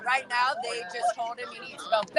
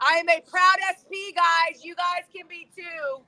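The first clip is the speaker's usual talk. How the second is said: raised, higher shouting voice; real protest shout; 4 semitones higher, 4 dB louder than usual. Real, not acted. Protester addressing Sea Org members walking by, shouting. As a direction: shout it out to people walking past.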